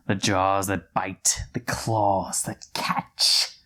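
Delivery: measured, dramatic poem reading with pauses; theatrical verse reading; poem recital. Acted. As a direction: recite the nonsense poem like a dark warning, drawing out key words. Reads a poem aloud.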